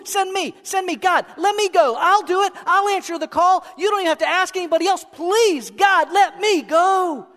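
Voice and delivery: high-pitched, frantic pleading; urgent, over-eager volunteer's appeal; begging. Acted. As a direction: plead like a pupil desperate to be picked. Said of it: "He's begging".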